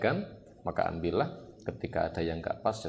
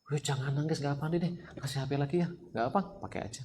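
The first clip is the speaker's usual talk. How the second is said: higher, sweet pleading to soothe; coaxing parent; 7 semitones higher than usual. Retold or imitated. Parent bribing a crying child with the phone, coaxing. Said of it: "kasih iming-iming".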